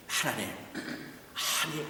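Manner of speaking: quiet, slow excuse to God; hesitant soft protest. Acted. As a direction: begin the excuse quietly and slowly. David answers God.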